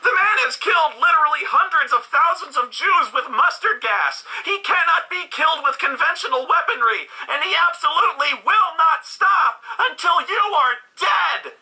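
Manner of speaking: hammed-up high intensity; mock dramatic hype. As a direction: oversell it like a trailer. Parodying movie hype.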